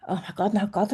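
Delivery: a real catch of feeling, breathed almost silently; emotional whisper. Real, not acted. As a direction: whisper it as the feeling suddenly hits you. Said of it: "it's making me emotional now".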